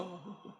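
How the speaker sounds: soft, high intake of breath; startled gasp. Acted. Princess seeing the long-awaited ring, soft startled gasp. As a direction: gasp softly in wonder. Game master voices the princess gasping.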